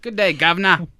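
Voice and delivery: loud, high caricature British greeting; mock British accent. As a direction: boom it out like a stage Brit. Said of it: "it's British".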